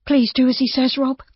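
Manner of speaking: high, anxious plea. A narrator's reading. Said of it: "Judy said anxiously".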